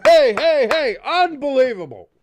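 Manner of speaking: high, loud hey hey cheer. Real, not acted. Streamer cheering the score, high loud whoop. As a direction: whoop it up in disbelief. Celebrating touchdown.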